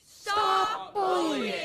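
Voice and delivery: put-on very high, slow, loud child shout; drawn-out squeaky yell. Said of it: "that video of those kids".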